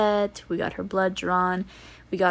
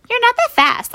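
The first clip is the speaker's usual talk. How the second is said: mock kid taunt; 6 dB louder than usual. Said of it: "And he's like".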